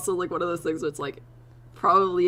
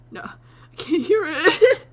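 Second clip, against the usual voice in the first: exasperated whine; 12 semitones higher, 10 dB louder, slower than usual. Retold or imitated. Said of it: "and I'll be like".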